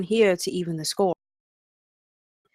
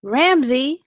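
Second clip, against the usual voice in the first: calling out; 6 semitones higher, 5 dB louder than usual. Acted. Lola calls out in the fight.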